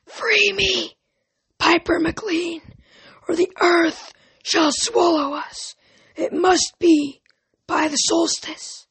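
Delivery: high, slow, ghostly echo from far away; like an older woman calling down a long pipe; echoing distant voice. A narrator's reading. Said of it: "like an older woman, speaking from somewhere far away, down a long echoing pipe".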